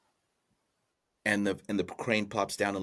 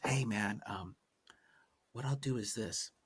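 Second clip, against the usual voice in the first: soft, secretive half-whisper; whispering crane; 6 dB quieter than usual. Acted. Crane tells the farmer its plan in his ear, mock whisper. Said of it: "the crane whispers".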